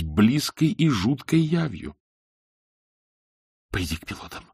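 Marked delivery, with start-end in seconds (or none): 2.8-4.4 whispered plea. A narrator's reading. Frightened man whispering to his captor. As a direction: breathe it out so only he hears. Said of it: "прошептал он".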